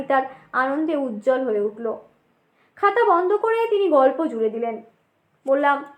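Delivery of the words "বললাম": ordinary talk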